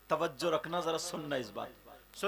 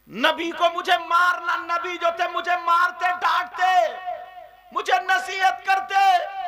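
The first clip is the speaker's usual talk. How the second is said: anguished lament; 13 semitones higher, 13 dB louder than usual. Acted. Hafsa lamenting.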